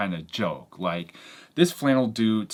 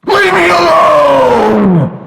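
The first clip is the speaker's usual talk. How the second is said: slow, very loud high yell; imitating a stranger snapping in a loud shout; 19 dB louder, much slower than usual. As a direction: bellow it to drive him away. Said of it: "this dude shouted at me".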